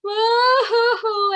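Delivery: high, loud mock wail with sobs; wailing bear. A narrator's reading. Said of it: "he wails and he groans".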